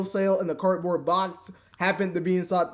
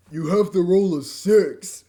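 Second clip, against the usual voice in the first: You have to roll a six, slowed and a little louder; slow character line; 6 dB louder, slower than usual. Acted. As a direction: read the game character's order slowly. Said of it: "I screwed up my line".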